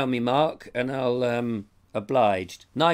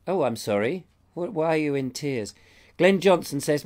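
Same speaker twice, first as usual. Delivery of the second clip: ordinary delivery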